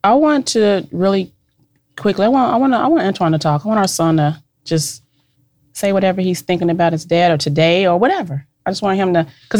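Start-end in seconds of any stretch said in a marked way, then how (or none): none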